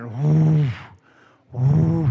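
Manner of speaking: low, breathy huffs of a lion marking territory; imitated lion breath, low and loud; lion huffing. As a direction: puff out a lion's steamy morning breath. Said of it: "it's doing that".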